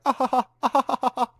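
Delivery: high, rhythmic mock laugh; imitated skating laugh. Skater having fun at a rink party, mock laughing. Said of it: "where you're just like".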